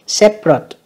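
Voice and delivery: Separate, first syllable high, then falling; stress on first syllable. Shown as a demonstration. Teacher checking stress for students, first-syllable stress on Separate. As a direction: hit the start of Separate. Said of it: "nhấn âm đầu".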